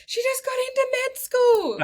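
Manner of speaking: high, loud squeal of news; excited announcement. Retold or imitated. Friend telling the cafe, squealing with excitement.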